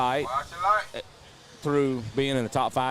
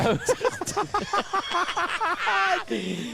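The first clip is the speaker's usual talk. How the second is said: drawn-out high-pitched laugh; long high laugh. Real, not acted. Co-host laughing at Billy's wrong-race answer, long and high.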